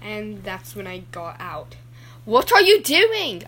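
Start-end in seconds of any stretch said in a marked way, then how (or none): 2.3-3.4 loud slow shout; yelled. Retold or imitated. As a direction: shout it at her across the beach. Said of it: "I yelled at your mom".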